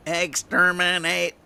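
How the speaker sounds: high, slow, loud robotic Dalek chant; mock Dalek screech, drawn out. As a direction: grind it out like a Dalek. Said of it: "And it was a Dalek".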